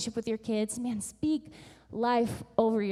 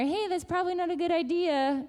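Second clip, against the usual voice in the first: higher-pitched quick warning; cautioning aside; 8 semitones higher than usual. Retold or imitated. Someone who sees around the corner gives advice, cautioning. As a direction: pipe up with a friendly caution.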